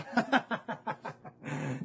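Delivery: cruel villain's laugh; official's mocking laugh at the mother; sinister laugh. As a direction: laugh it off like a threat. Official laughs after threat.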